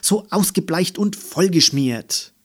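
high, loud fault-finding; scolding. A narrator's reading. Mama listing the old backpack's faults, scolding. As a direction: keep railing, hitting each flaw. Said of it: "schimpfte Mama".

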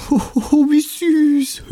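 very high mocking ho ho ho; sneering. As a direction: jeer at the pair in a high voice. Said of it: "höhnte ein Löwenzahn". (höhnisches hohes Ho ho ho)

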